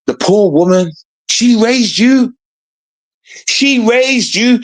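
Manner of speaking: each word drawn out and stressed; slow emphatic. Real, not acted. Host lecturing Jimmy about his poor mother, slow and emphatic. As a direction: lay it on slowly for effect.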